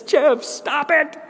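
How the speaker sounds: high, slow mock scolding; gentle rebuke. Holy Spirit telling Jeff to let students go, slow mild rebuke. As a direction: scold him slowly, half teasing.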